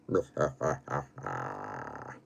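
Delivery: low, slow villainous chuckle; deep mock-sinister laugh; evil laugh. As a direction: laugh like a horror-film villain. Villain laugh before horror recital.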